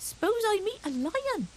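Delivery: put-on high, eager small boy's boast; a small hedgehog bragging to his cousins; little hedgehog voice. Little hedgehog boasting to his cousins, high and eager. Fuzzy Peg asks mother.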